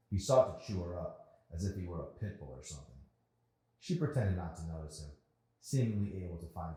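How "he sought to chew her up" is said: ordinary delivery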